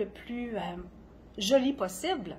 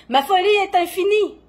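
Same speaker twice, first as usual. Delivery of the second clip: high, loud poetic outcry; exalted proclamation; 9 semitones higher, 11 dB louder than usual. Acted. Poet proclaims their madness to listeners, exalted outcry. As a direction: cry it out at full voice, dropping on the last word.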